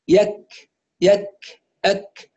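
يك stopped hard, then a small release of air; firm then breathed. Shown as a demonstration. Teacher models the correct kaf, firm then breathed. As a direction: close firmly on k, release lightly. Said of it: "فصوتها يبدأ بشدة وينتهي بهمس".

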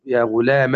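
يا غلام ending in "a", ghulama; final fatha. Shown as a demonstration. Teacher showing students three vowels on the meem, "a" ending on يا غلام. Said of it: "أي بثلاث حركات على الميم".

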